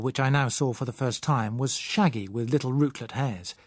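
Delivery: plain reading voice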